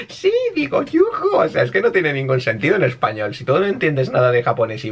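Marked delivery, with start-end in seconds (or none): 0.1-1.3 very high mock-girly voice; piping, hammed-up self-correction; imitación burlona. Mocks the subtitle again. (falsete afeminado y burlón)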